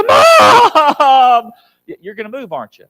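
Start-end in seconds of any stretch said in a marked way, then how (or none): 0.0-1.5 loud, high, panicked "Mom!"; urgent child's cry. Retold or imitated. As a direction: yell it like a child in real trouble. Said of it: "when you hear your cry, go".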